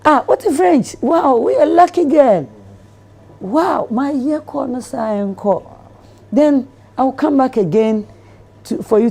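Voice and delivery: a man over the moon with the deal; delighted man. Retold or imitated. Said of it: "He's like".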